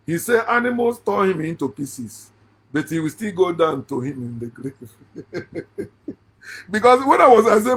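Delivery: ordinary talk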